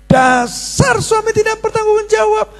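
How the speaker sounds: high mock wife's insult; wife nagging her husband, pitched high; shrieking wife.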